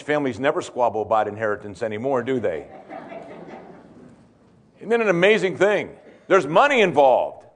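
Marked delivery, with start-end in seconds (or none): 0.0-6.0 low, wry aside; quick, deadpan irony dropping low; dry sarcasm. Real, not acted. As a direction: say it drily, tongue in cheek. Sarcastic aside on changed times.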